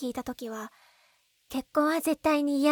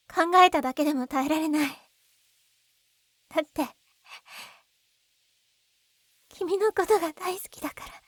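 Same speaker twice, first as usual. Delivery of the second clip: rising confession; 3 semitones higher, 3 dB louder than usual. Acted. Confessing love, emotion rising.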